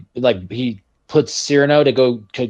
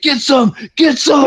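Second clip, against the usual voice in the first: high, pumped-up war cry; hammed-up, high-pitched movie scream; imitated battle scream; 9 semitones higher, slower than usual. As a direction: yell it like a soldier mowing down bugs.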